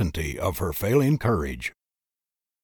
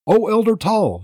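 pleading cry, much higher; 12 semitones higher than usual. A narrator's reading. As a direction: call out to stop the elder. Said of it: "she cried".

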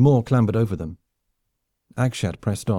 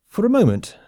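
usual speaking voice

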